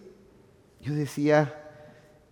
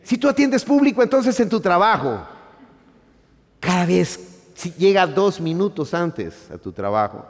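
much higher and louder, full-force sermon delivery; fiery preaching; 7 semitones higher, 6 dB louder than usual. Acted. Pastor proclaiming to the congregation that God will change our character, fiery.

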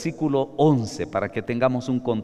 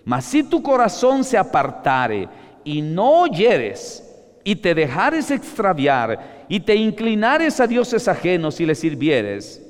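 higher, loud and slow warning; stern preaching; 6 semitones higher, 6 dB louder, slower than usual. Acted. Preacher warning the congregation from Scripture, stern and loud. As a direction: thunder the warning slowly.

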